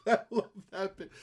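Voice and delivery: delighted and cracking up; laughing. Real, not acted. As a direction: say it through a real laugh. Laughing at the remembered bit.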